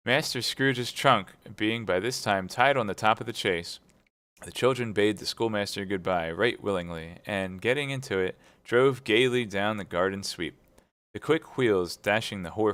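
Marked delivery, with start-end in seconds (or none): none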